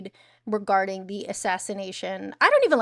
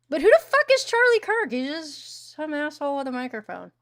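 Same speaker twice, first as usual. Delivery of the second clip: indignant outburst; 5 semitones higher than usual. Real, not acted. Angry rant about Charlie Kirk.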